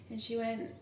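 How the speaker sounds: normal talking voice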